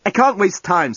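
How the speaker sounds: high-pitched impatient retort; snapping. A narrator's reading. Journalist hurries to leave captive, impatient snap. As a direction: brush her off with urgent irritation. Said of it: "snapped the journalist".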